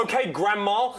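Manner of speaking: high, loud, pushy prodding; mock exasperated urging. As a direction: prod him like an impatient fan. Mock urging Barry to react.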